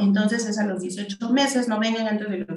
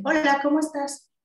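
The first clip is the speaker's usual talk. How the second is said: eco agudo del niño, repite la frase; niño repitiendo; 6 semitones higher than usual. Shown as a demonstration. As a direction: imita al niño que repite el saludo en eco. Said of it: "Y el niño te dice". (eco agudo del niño con ecolalia)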